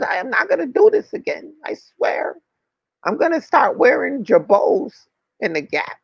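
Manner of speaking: high, pleading mock prayer voice, begging to be let out; mock desperate prayer. Locked up, pleading with God to be let out: high desperate begging.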